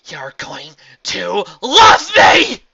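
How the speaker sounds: very high, loud, slow shout; furious shout. A narrator's reading. Astor shouts a threat at Celestia. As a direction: roar it out as a threat.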